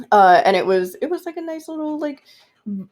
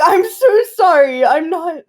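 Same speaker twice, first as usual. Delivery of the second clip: flustered apology; 8 semitones higher, 5 dB louder than usual. Retold or imitated. Apologizing to a partner for laughing, flustered. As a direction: plead innocence in a high voice.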